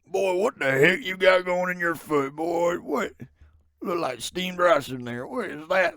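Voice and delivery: loud, high, drawn-out dad voice; hammed-up shouting dad; impression of dad. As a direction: do your dad bellowing in mock shock. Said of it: "do an impression of my dad".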